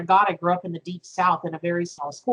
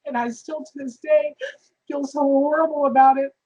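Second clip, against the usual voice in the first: real tears, voice higher and breaking; fighting through sobs as the guilt comes back; crying; 6 semitones higher than usual. Real, not acted. Telling the host about her sold pig, crying for real. Tearful memory of lost pig.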